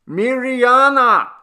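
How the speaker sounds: long high call of her name; shout. A narrator's reading. Old man shouting to the deaf grandmother. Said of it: "then shouted".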